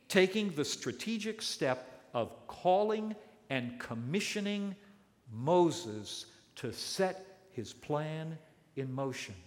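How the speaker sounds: ordinary talk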